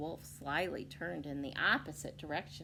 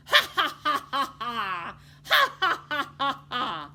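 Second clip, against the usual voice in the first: loud high cackling ha-ha; evil chuckle; 7 semitones higher, 13 dB louder than usual. Shown as a demonstration. Reader showing children an evil chuckle. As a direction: cackle like a cartoon villain. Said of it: "Can you give an evil chuckle?".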